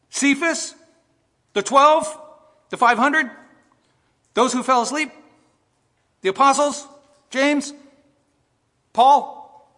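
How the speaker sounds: high, loud one-name questions with pauses; mock-doubting roll call, each item tossed up loudly; incredulous questioning. Shown as a demonstration. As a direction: challenge the room with each name. Rhetorical roll call of wrong answers.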